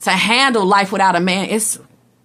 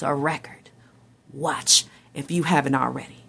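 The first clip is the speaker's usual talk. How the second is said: whispered aside; 6 semitones lower, 7 dB quieter than usual. Real, not acted.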